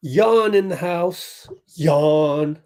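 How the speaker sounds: put-on slow, drawn-out light Brazilian accent; Leandro Barbosa voice. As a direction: stretch the name like a Brazilian commentator, lightly. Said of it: "Blur Barbosa voice right there".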